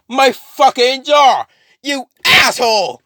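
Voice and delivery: high, loud, drawn-out fury; acted furious shout; outraged yell. Carnage rages about his jaw.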